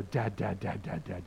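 imitated playful dad-calling. Preacher playing with Cliff to make him laugh, light low chanting. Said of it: "he laughs now when I go".

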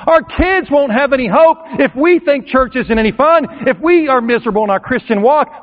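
fiery sermon climax; preaching at full force. Acted. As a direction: build it up and preach hard. Preacher rouses the crowd.

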